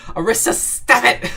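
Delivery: high, loud, laughing scold; laughing protest. Real, not acted. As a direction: scold a friend loudly through laughter. Scolds a gift giver, laughing.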